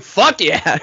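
punchy, excited cheer; mock celebration. Friend imagining celebrating a new dog, triumphant.

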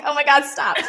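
high, loud, through laughter; laughing mock protest. Parent amused at copycat son, laughing protest. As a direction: protest while cracking up.

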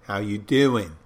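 put-on Philly-Jersey accent, punched then drawn out; imitating a New Jersey local; New Jersey-Philly greeting. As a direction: do a cocky Jersey "how you doin'". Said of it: "New Jersey, Philadelphia region and stuff, and they always go".

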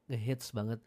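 usual speaking voice

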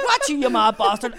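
high, loud shout of abuse; imitating her furious outburst; outraged yell. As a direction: lay into him at full volume. Woman in an acted bathroom quarrel.

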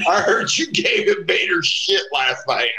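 lightly voiced wrestler teasing the new kid; imitated wrestler. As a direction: drawl it out like a teasing locker-room veteran. Wrestler quoted mid story.

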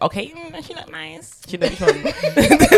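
playful mock quote.

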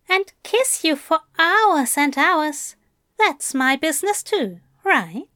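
Aziraphale. Acted. Angel to bound Crowley about touching him, fondly teasing. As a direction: tease him lightly, voice lifted.

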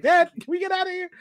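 put-on high-pitched child voice; pleading kid. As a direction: beg Dad in a small high voice. Acting a child pleading to Dad.

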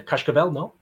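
Cascavel with a Portuguese "sh" before the k; Portuguese accent. Real, not acted. Host trying the team name, unsure, in a Portuguese accent. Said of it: "you say Cascavel in a Portuguese accent".

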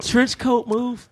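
slow, high, savoring each word; drawn-out high hype. Pretend. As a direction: relish it like a legend. Savoring the movie moment.

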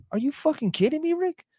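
high, fast, disbelieving; are you kidding me energy; incredulous outburst. Retold or imitated. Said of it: "And I'm like".

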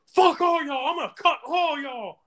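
high-pitched mock tantrum; mock enraged wail. Joe threatening the dorm, mock enraged wail. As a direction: imitate a hysterical threat, voice way up high.

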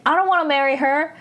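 teasing refusal, hammed-up and fast; mock indignant protest. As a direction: whine it like a bickering friend. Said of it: "Park Seo-joon gets mad".

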